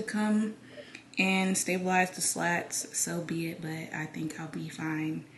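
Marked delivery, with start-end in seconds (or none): 3.0-5.2 soft unvoiced whisper. Real, not acted. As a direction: breathe it quietly. Whispered aside.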